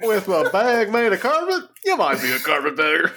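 put-on Southern accent, comedian's setup delivery; hammed-up Southern stand-up; mock Southern comic.

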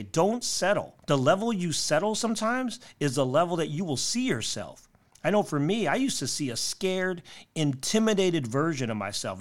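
bright, punchy motivational tips; upbeat tidy advice vlogger. Acted. Closing tips pep talk.

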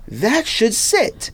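ordinary talk, nothing marked

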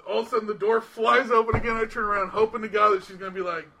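normal talking voice

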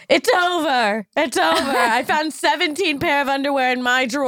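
high, dramatic breakup lament; mock distraught. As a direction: ham up the heartbreak. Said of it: "I'm like".

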